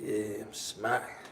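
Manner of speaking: low, slow, quiet stoned mumble. Retold or imitated. Voicing his stoned self, low and slow.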